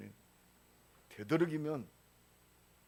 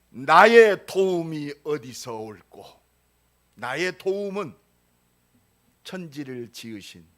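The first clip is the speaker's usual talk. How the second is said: loud, slow, ringing scripture recital; psalm recitation; 4 semitones higher, 14 dB louder than usual. Acted. Preacher reciting Psalm 121 to the congregation, loud and slow. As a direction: proclaim the psalm to the hall, slowly.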